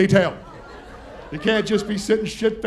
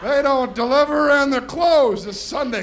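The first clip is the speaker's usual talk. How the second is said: put-on drunk trying to sound sober; 4 semitones higher than usual. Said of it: "trying to sound sober".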